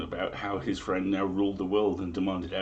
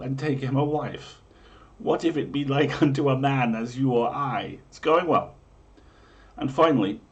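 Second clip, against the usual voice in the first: grand, raised olde-English storyteller read; dramatic prophecy reading; 4 semitones higher than usual. Acted. Host reading medieval omens to listeners, grand storyteller voice. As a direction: proclaim each omen like a town crier.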